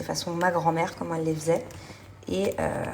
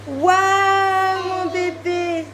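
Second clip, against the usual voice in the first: high sweet mom voice; 10 semitones higher, 8 dB louder, much slower than usual. Real, not acted. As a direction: coo over the gift like a proud mom. Mother cooing to child.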